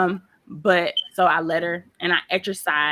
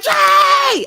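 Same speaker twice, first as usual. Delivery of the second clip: much higher, loud call of the name; shout; 10 dB louder than usual. Retold or imitated. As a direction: yell her name across the room. Coworker yelling name.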